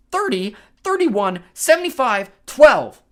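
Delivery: slow, loud, high wail of numbers. A narrator's reading. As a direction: wail each number out desperately. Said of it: "Anger wailed".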